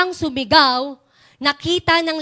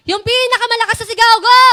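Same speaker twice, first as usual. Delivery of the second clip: louder, quicker call to the room; loud urging; 5 semitones higher, 6 dB louder, faster than usual. Real, not acted. Teacher calling the children to shout their loudest.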